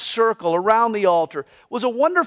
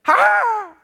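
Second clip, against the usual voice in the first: sudden high harsh cry, much higher; raven bellow; 10 semitones higher, 3 dB louder than usual. Shown as a demonstration. Preacher demonstrating the raven's cry, a sharp shout. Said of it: "literally means the bellow of a raven".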